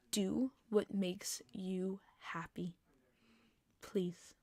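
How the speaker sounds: hushed, slow encouragement for a little fan; soft whisper. Real, not acted. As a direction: whisper it gently and slowly. Gentle encouragement to a young fan.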